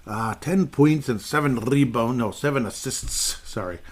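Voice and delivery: slow, lightly put-on Scottish accent. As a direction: read the stats in a Scottish brogue. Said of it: "that's another accent".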